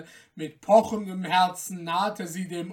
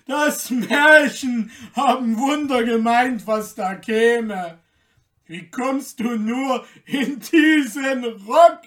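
loud, higher, scornful mockery; jeering shout; 4 semitones higher, 10 dB louder than usual. A narrator's reading. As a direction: shout the ridicule across the gate. Guards jeer at Märchen.

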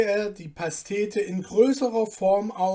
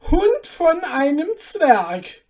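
much higher, much louder, slow roar; a lord exploding at his servant; enraged royal bellow; furious duke shouting; 10 semitones higher, 11 dB louder, slower than usual. Acted. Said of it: "rief er".

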